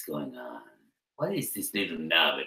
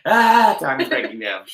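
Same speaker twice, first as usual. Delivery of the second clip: mock panic; 8 semitones higher, 9 dB louder than usual.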